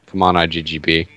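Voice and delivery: ordinary delivery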